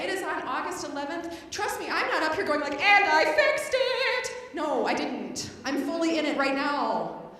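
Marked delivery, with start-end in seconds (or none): 2.8-4.5 slow, sung, higher triumph; hammed-up victory tune; mock triumph. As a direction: sing it smugly. Mocking triumph.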